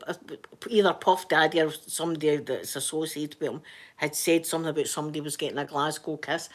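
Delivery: normal talking voice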